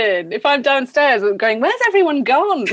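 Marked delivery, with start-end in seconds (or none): 1.6-2.6 high-pitched mock bewilderment; puzzled bride calling out. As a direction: call out as if playing a deserted host. Imagined bride calling for missing guests.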